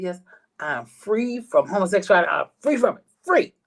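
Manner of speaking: rising, delighted proclamation; joyful announcement. Retold or imitated. Telling mother on the phone, a rising delighted proclamation. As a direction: announce your freedom with glee. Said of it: "I said".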